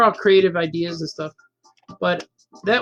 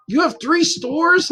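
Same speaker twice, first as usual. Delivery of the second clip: high, drawn-out incredulous question; mock amazement, pitched well up; retold disbelief; 8 semitones higher, slower than usual. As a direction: imitate an astonished neighbor, stretching it out. Neighbour reacts to three stores.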